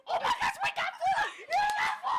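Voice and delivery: high, loud squeal of joy; real excited shouting. Real, not acted. Celebrating four viewers.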